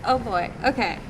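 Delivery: ordinary delivery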